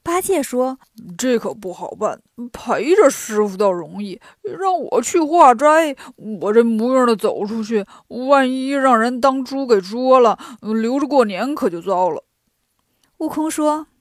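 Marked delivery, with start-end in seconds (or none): 1.2-12.2 grumbling, reluctant Pigsie. A narrator's reading. Pigsie balking at Wukong's task of begging for food, reluctant. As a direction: play Pigsie whining about the job.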